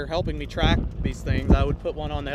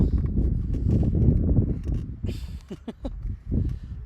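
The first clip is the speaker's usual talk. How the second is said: airy, breathy laugh; flustered laugh; 5 semitones higher than usual. Real, not acted. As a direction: laugh it off under strain. Saving umbrella while filming.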